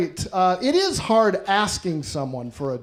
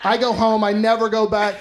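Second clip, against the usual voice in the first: ordinary delivery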